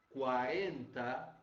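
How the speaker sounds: "quarenta" said slowly with a clear QU sound; a teacher modelling the word; slow model word. Shown as a demonstration. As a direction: draw out "quarenta" syllable by syllable. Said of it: "palavras como quatro".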